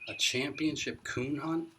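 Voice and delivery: can it really be true; amazed question. A narrator's reading. Boy repeats the headline to grandpa, amazed.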